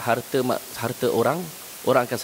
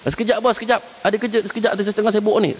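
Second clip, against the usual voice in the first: higher, hurried put-off to the boss; stalling worker; 8 semitones higher, faster than usual. Retold or imitated. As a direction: brush the boss off for a moment. Angestellter vertröstet wieder.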